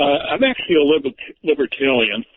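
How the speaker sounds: ordinary talk, nothing marked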